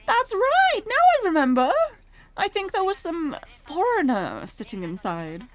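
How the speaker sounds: put-on high, loud British older woman; light up with sudden recollection, pitched right up; proprietress suddenly remembering. Shop woman recalls the foreigner in the van, high excited recollection.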